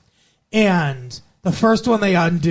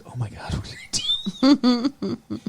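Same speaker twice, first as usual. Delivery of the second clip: higher, slow stunned realisation; a retold Cordelia caught off guard; dismayed gasp; 8 semitones higher, 3 dB quieter, slower than usual. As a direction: let it dawn on her, aghast. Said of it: "And Cordelia's like".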